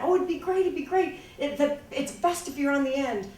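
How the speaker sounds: a hint of a scheming brother's sales pitch; mock coaxing brother. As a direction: coax her on like a sly older brother. Brother coaxing sister onto seesaw.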